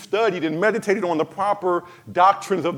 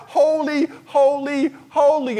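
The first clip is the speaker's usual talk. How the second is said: crying out holy; 7 semitones higher than usual. Acted. Angels crying out, preacher echoes.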